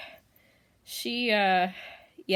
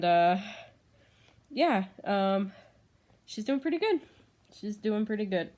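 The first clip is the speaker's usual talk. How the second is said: ordinary talk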